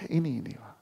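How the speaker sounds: normal talking voice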